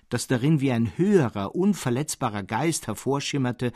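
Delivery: plain reading voice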